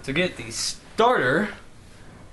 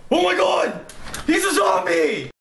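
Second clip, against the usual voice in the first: terrified shout; 10 semitones higher than usual. Acted. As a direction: scream it in horror. Spots a zombie.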